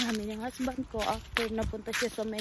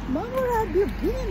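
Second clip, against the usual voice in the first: daughter's voice; 12 semitones higher, 8 dB louder than usual. Retold or imitated. Daughter quoted in mom's story.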